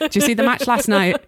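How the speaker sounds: a Manchester football fan's voice; Manchester accent. Acted. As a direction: put on a Manchester accent, chatty. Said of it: "Trying to do a Manchester accent".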